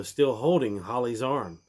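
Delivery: usual speaking voice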